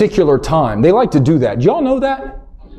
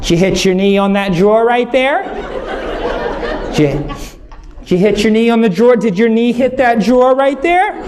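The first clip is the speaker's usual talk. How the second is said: higher, doting voice, lightly put on; fussing wife; 6 semitones higher than usual. Wife Whitney fussing over her hurt husband, lightly mocking doting voice. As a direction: coo over him like a worried wife. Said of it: "She goes".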